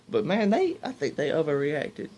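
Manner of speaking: usual speaking voice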